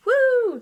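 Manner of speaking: high, loud "woo"; celebratory whoop. Real, not acted. Student cheering her ninety-eight, high celebratory whoop. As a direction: whoop in triumph.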